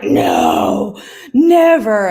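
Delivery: mock denial. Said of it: "they're like".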